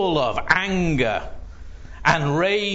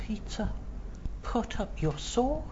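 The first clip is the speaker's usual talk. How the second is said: gentle rebuke; 6 semitones higher, 10 dB quieter than usual. Acted. Jesus rebukes Peter gently.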